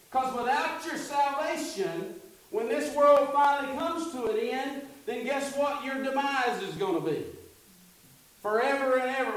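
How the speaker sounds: high, loud preaching climax; fired-up preacher driving the point home; full-force preaching. Acted. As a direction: preach it up, rising to a peak. Preaching builds.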